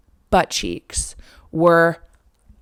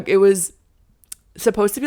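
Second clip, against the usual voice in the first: ordinary delivery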